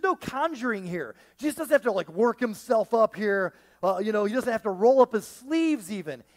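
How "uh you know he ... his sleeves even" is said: ordinary delivery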